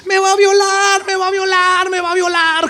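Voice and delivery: high, shrill-pitched mock cry of accusation; mock accusing scream. Preacher voicing Potiphar's wife accusing Joseph, high mock cry. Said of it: "comenzó a gritar acusándolo".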